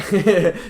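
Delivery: short, high burst of laughter; sudden loud chuckle. Real, not acted. Laughs between shout outs.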